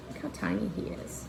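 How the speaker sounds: ordinary delivery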